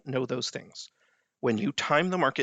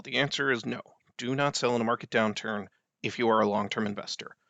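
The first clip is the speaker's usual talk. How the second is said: ordinary talk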